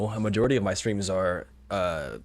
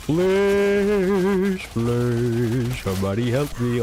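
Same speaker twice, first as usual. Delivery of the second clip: pleading for help; 4 semitones higher, 5 dB louder, much slower than usual. Pretend. Mock plea while being stabbed.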